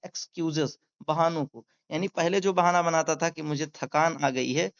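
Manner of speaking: normal talking voice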